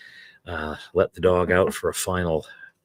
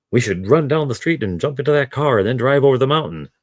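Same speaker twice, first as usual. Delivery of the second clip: a slightly raised mock English accent read; 7 semitones higher, 3 dB louder than usual. Reader starting a book dialogue line, in a light English accent. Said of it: "in an English accent".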